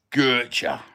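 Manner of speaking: Cockney phrase in two separate parts for repeating; Cockney repeat-after-me. Shown as a demonstration. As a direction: teach the Cockney retort, pausing between parts. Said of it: "So say it after me".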